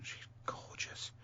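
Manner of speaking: breathy whispered swoon; whispering. Real, not acted. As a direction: whisper it dreamily. Swooning over a presenter.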